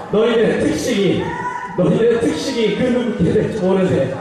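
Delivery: high, archaic commanding tone; old-fashioned MC. Acted. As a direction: play a theatrical game host. MC in an archaic style. (사극풍 진행자 말투)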